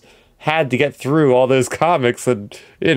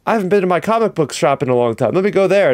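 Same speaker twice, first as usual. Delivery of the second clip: mock excited fan; 6 semitones higher than usual. Mock fan suddenly wanting comics.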